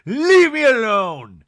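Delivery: loud mock protest, moderately high; toned-down outburst. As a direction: belt it slowly as an imitated demand. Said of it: "She just goes".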